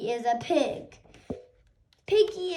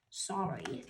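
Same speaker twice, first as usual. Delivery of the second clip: much lower, much softer sorry; quiet sorry; 11 semitones lower, 11 dB quieter than usual. Acted. Gerald apologises to Piggie after sneezing, very soft. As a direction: shrink down and apologise.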